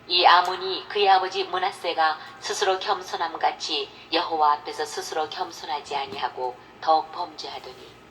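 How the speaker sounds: ordinary delivery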